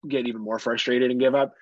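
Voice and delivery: ordinary talk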